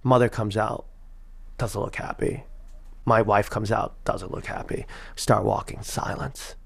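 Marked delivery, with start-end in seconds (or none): 1.6-2.4 breathy whisper, low and flat; hushed, ominous whisper; whispered aside. Retold or imitated. Describing a tense silent scene. 4.0-4.9 breathy whisper, low and flat; hushed, ominous whisper; whispered aside. Retold or imitated. Describing a tense silent scene.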